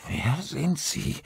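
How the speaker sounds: stifled scream. A narrator's reading. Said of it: "wollte Olli schreien".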